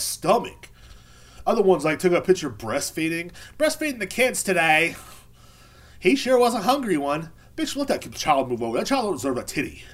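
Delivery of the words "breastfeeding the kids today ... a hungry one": higher put-on caption voice; mock proud mother. Mother posting a breastfeeding picture, mock proud. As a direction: chirp it in a hammed-up high voice.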